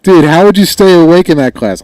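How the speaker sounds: high, booming outburst into the mic; loud, high-pitched incredulous questioning; too loud. Real, not acted. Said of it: "gotta get away from the microphone".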